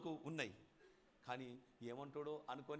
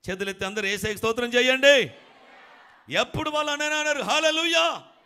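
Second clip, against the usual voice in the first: rousing preaching; 2 semitones higher, 18 dB louder than usual. Acted. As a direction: whip the congregation up to shout hallelujah. Preacher rouses congregation to praise.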